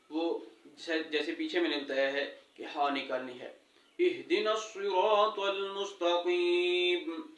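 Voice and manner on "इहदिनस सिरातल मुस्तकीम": slow, drawn-out tajweed recitation; Quran recitation. Shown as a demonstration. Teacher reciting Fatiha to students, slow and measured. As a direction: recite each word slowly and carefully.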